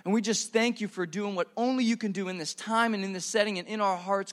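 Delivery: soft, low closing prayer; hushed pastor's prayer. Real, not acted. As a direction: pray it quietly over the room.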